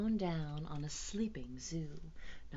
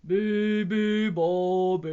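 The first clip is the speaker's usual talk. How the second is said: loud, slow bear bellow; bears' drawn-out bellowing chant; 3 semitones higher, 13 dB louder, much slower than usual. A narrator's reading. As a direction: boom it out, long and heavy. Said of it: "bellowed the bears".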